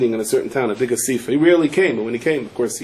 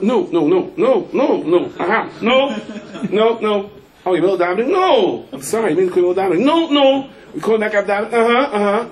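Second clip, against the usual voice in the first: hurried phone brush-off; 7 semitones higher than usual. Retold or imitated. Husband brushing off wife on phone.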